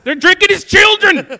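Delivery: very high, loud outcry; mock horrified shout. Joking to friends about beer emojis, high mock-horrified shout. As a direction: shriek it in hammed-up horror. Mock horror, repeated louder for laughs.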